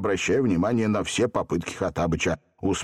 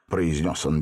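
plain reading voice

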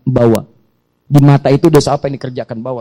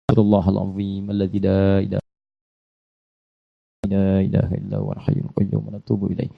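longer istighfar; 5 semitones lower, 5 dB quieter than usual. Shown as a demonstration. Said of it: "Kalau mau agak pa".

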